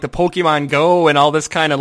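normal talking voice

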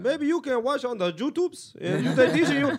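high, loud, slow mock Mexican accent; hammed-up Mexican-accented landlord. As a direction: imitate a Mexican landlord drawing out his advice. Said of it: "like the Mexican landlord".

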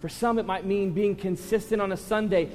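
loud, high, driving sermon voice; preaching at full force. Real, not acted. Pastor urging the congregation to serve, full preaching force. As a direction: push the appeal hard to the room.

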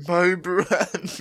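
dramatic advert voice. Retold or imitated. Repeating the advert line.

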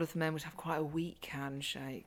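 hushed confiding whisper. Real, not acted. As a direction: whisper it like a secret. Gossiping aside about family men.

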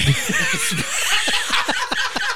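long squealing giggle; helpless high laughter; high giggling fit. Real, not acted. Laughing at nickname.

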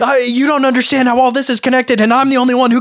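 high-pitched, agitated ranting; high, unhinged conspiracy rant; frantic conspiracy theorist. Acted. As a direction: rave like you alone see the connections.

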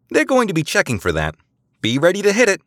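high, loud quick order; urgent instruction. A narrator's reading. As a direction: rush the crew into action. Eliza instructs crew urgently.

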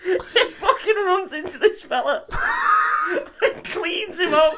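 high, loud, helpless laughing delivery; creased with laughter. Real, not acted. Man tells friend of bull flattening a fella, helpless laughter. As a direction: squeal the story out through real laughter. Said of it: "I was fucking creased off it".

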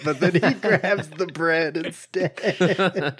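chuckling at the punchline; laughing. Real, not acted. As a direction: giggle through the punchline. Laughing while describing funny scene.

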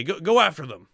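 shouted order. Acted. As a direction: bark it down the corridor at the others. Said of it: "you hear one of them say".

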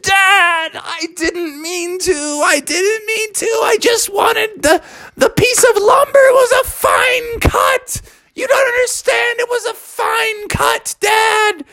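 hammed-up blubbering; wailing cub. Bubby explains himself to his dad, mock sobbing.

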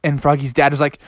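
usual speaking voice

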